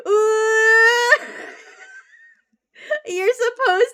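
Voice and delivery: high, slow, drawn out with a laugh; dictated line, drawn out. Shown as a demonstration. Streamer dictating a line to the chatbot, drawn out and slow. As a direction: stretch each word out while dictating.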